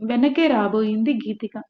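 plain reading voice

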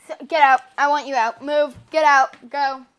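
loud repeated get-out; yelling. Real, not acted. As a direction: shout them out the door. Shouting sister out of room.

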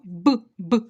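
"Б, б" as two separate punchy lip sounds; isolated labial sound. Shown as a demonstration. Teacher showing a lip consonant, isolated "Б, б". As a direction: pop the sound "Б" twice on its own. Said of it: "це губний".

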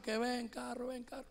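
soft, shy muttered request; embarrassed, hushed. Retold or imitated. Man too ashamed to shout for a car, hushed. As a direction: say it under your breath, trailing away. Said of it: "No podía gritar porque me daba vergüenza".

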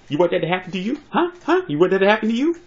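high, rising confrontational questions; cocky, high-pitched taunting; mock challenge. Acted threat retold to his partner.

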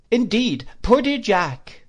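put-on high, fluttery elderly mother; fussy old lady. A narrator's reading. Old lady voiced in story.

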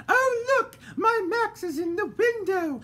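put-on high, slow, prim old lady voice; a genteel lady fretting in the dark; voiced lady character; fussy lady. A narrator's reading. Lady Dandelion.

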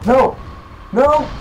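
two high cries of protest; real yelps of dismay; dismayed shouts. Real, not acted. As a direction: yell at the game in frustration. Dying again in game.

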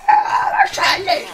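high slow drawn-out shout. Acted. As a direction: stretch it out, yelling high. Mock shout.

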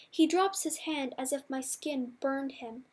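ordinary delivery, nothing marked

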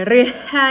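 stretched, wrong guess at reading rhythm; naive misreading. Acted. Learner stumped by a word with no visible vowels, naive misreading. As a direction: sound out rhythm letter by letter as a clueless learner. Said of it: "ดูเหมือนไม่มีสระเลยนะคะ".

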